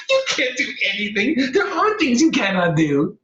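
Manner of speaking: very high, loud, cackling taunt; mocking laughter. Chipmunk laughing and taunting the defeated bear. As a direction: laugh right in the bear's face. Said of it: "She laughed at the bear".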